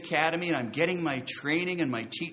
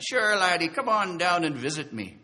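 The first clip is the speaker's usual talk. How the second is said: put-on hearty, louder Irish accent; 4 semitones higher, 5 dB louder than usual. Mission friend inviting him down, in an Irish accent. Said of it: "he goes in his Irish accent".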